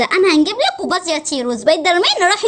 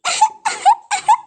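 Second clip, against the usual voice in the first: high mock sobs; pretend crying; 10 semitones higher than usual. Mermaid keeps weeping before her friends, pretend crying. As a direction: sob in short high bursts, play-acted. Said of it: "ما تعيطيش تاني".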